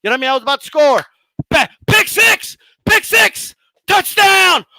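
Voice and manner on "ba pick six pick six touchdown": high, loud touchdown yell; victory shout, slow on the first call; triumphant cheer. Pretend. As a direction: celebrate like you just scored. Celebrating his own touchdown.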